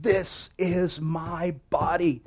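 slow, high, emphatic declaration; Luther pounding the table. Retold or imitated. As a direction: proclaim it like Luther banging the table. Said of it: "he would pound the table".

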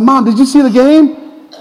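high, drawn-out eager question; excited son. Retold or imitated. Son phoning mom after winning the game, excited. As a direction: ask it high and bursting for praise.

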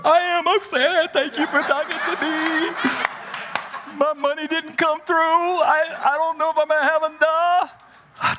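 put-on high, tearful girl's voice, slow at first; upset student. Homesick freshman confiding her money trouble, high and tearful. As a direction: plead in a small, high voice. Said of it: "Don't tell them I'm crying".

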